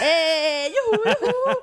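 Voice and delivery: high loud whooping; excited whoop. Real, not acted. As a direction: cheer with a laugh. Excited whoop over guest.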